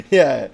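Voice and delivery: big cackling laugh. Real, not acted. Big laugh after joke.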